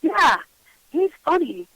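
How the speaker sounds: put-on high little-kid reply; lightly voiced small child, lifted in pitch; imitated three-year-old. Child telling mom about invisible friend, childlike and sure. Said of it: "And she was like".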